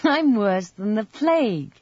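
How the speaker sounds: put-on high, teasing girl voice; lightly laughing young girl; playful girl. A narrator's reading. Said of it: "laughed for the first time".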